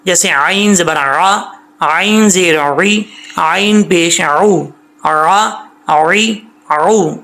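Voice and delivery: each syllable drawn out, ain from the throat; slow spelling drill. Shown as a demonstration. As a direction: spell عین زبر آ slowly and clearly. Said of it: "ہجے کریں اس کے،".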